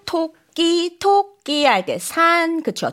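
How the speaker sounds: ordinary talk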